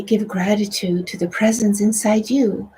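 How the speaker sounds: normal talking voice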